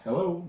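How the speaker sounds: "Hello" lifted on its last syllable; rising iambic stress. Shown as a demonstration. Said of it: "My eyebrow goes up and going up".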